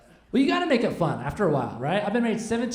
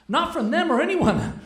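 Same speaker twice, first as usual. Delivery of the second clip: high, loud, hammed-up protest; acted defensive outburst; mock-indignant denial; 8 semitones higher, 6 dB louder than usual. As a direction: protest loudly in mock alarm. Retelling his startled denial to wife.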